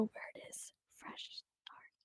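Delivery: slow voiceless whisper, words drawn out. Real, not acted. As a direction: whisper it slowly so lips can be read. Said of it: "the point is to whisper it".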